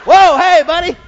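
loud, high jolt of surprise; mock startled greeting. Puppy's owner meets stranger in bathroom stall, startled loud greeting.

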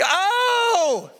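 high, drawn-out wail of dismay; acted high-pitched shock; horrified cry. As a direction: cry out aghast. Reacting to the T-Rex shriek.

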